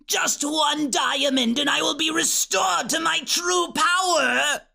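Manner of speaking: high, shrieking villain voice; Chaos Witch. A narrator's reading. Witch craves her true power, shrieking. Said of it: "shrieked the voice of the Chaos Witch".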